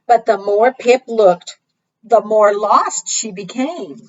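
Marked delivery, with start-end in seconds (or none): none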